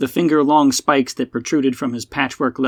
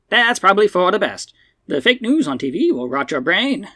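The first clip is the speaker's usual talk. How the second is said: put-on raised-pitch elderly broadcaster; old-man radio personality; 6 semitones higher than usual. Old man sighs over Buck having no TV, old-man radio voice. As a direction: pronounce it like an old twenties radio host. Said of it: "nineteen twenties radio personality with the old man accent".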